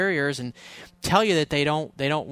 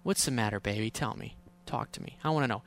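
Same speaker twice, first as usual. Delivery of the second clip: soft, teasing coax, much quieter, almost breathed; hushed playful sweet-talk to an upset girlfriend; 4 semitones lower, 7 dB quieter than usual. Retold or imitated. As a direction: coax her gently like a tease. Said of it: "start being playful and humorous".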